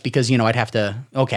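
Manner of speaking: normal talking voice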